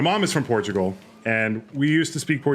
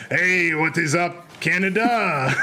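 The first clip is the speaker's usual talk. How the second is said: slow, higher Quebec French accent, hammed up; Jean Chrétien impression; 4 semitones higher, slower than usual. Mimicking the former prime minister Jean Chrétien to a friend, in a hammed-up Quebec accent. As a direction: greet the nation like a folksy Quebec prime minister. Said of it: "he definitely spoke like that".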